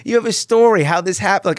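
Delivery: high, loud insistent no no; insistent push. Retold or imitated. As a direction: push him eagerly to share. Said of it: "He's like".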